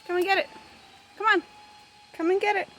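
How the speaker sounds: high loud feeding call; calling chickens. Real, not acted.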